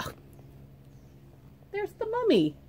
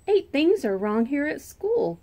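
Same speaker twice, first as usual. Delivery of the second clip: higher, louder protest; yelling; 6 semitones higher, 4 dB louder than usual. Acted. Child yells to the sisters about school. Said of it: "I yelled".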